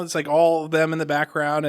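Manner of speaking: usual speaking voice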